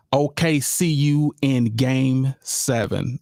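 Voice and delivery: "O K C U in game seven" read out slowly, spaced apart. Shown as a demonstration. Host reading a fan's caption to viewers, slow and deliberate.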